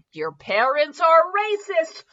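hammed-up accusation; mock outraged shout. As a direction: blurt it out in mock horror. Acted outburst.

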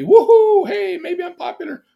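whooping, high and loud; hammed-up teenage elation; elated. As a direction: burst into a giddy, high-pitched cheer. Reenacts joy at party invitation.

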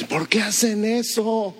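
slow, high mocking question; lightly voiced skeptic; puzzled critic. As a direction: mimic the doubters. Quoting critics of the church.

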